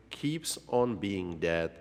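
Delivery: ordinary delivery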